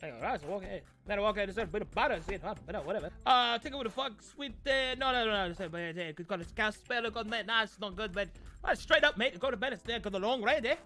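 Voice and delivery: put-on fast, high Pikish accent. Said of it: "That was me doing a Pikish accent".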